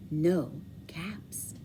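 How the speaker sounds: breathy whispered dismay. A narrator's reading. Storyteller whispering the missing caps, hushed dismay. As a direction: whisper it, the caps are gone. Fourth empty look.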